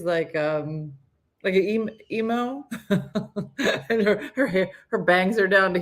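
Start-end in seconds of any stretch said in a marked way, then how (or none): none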